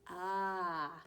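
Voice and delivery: usual speaking voice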